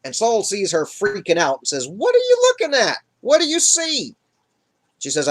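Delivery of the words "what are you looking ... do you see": very high, urgent questioning; alarmed. Retold or imitated. Saul seeing the witch freaking out, alarmed. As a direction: demand answers in a high-pitched panic.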